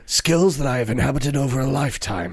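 lightly voiced low movie-threat delivery; a hint of the calm action-hero menace; Liam Neeson. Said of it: "That's the Liam Neeson".

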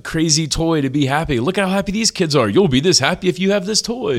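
high, excited mock commercial voice; toy ad pitch. Parent mimicking ads that pitch toys to kids, mock sales voice. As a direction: sell it like a hyped-up toy advert.

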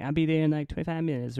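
high-pitched squealy caller voice; Mike Tyson. Retold or imitated. Triple A guy promising to come, high squealy voice. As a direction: do a bad, squeaky celebrity impression. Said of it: "I'm gonna stop doing the voice".

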